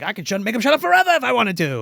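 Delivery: fast, high imitation of eagerness; acted rushed insistence; eager boast. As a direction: blurt it out, over-promising. Corky eagerly accepts the challenge.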